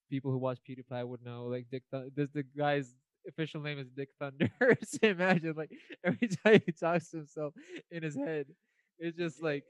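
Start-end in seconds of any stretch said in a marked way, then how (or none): 4.6-9.6 talking through laughter, higher; laughing. Real, not acted. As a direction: giggle while picturing the absurd. Cracking up at the name.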